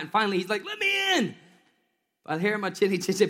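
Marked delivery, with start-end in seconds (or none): 0.6-1.3 high, pleading call. Retold or imitated. Peter outside the door calling to be let in, in a high plea.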